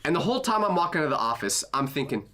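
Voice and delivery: ordinary talk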